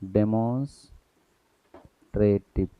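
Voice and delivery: dictation-pace डेमोन्स्ट्रेटिव; slow spelled-out word. Shown as a demonstration. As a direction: stretch डेमोन्स्ट्रेटिव out for students to copy. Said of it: "third क्या रहेगा".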